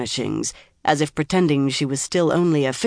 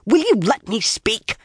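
higher, frustrated pleading bark; attempted bark; 6 semitones higher than usual. A narrator's reading. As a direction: try to bark, end up pleading. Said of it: "Nynaeve barked".

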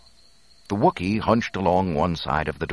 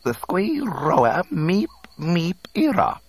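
high, slow, louder droid squeaks; civil alien speech; 13 semitones higher, 5 dB louder, slower than usual. A narrator's reading. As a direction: chirp politely in an alien tongue. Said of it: "he said with much civility".

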